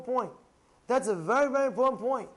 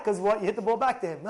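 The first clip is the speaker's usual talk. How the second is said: ordinary talk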